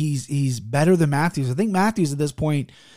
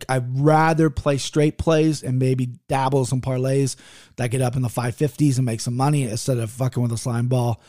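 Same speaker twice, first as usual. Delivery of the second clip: ordinary talk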